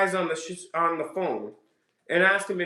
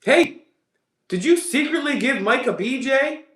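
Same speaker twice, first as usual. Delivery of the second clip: high, louder sarcastic hello; mock cheery call; 9 semitones higher, 3 dB louder than usual. Host mocks Mike's plan by acting out the call, high and loud. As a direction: play the caller as a naive fool.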